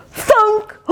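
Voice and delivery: high cry of pain. Acted. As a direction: yelp like a dog struck. Sound effect in picture.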